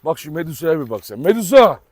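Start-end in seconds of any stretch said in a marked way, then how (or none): 1.2-1.8 loud call across the yard; calling the dog. Real, not acted. Owner calling his dog Medusa, loud call. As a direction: call her name out firmly.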